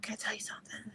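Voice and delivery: hushed, breathless whisper. Retold or imitated. Wife whispering a confession to her husband on the phone, hushed whisper. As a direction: whisper it like a secret.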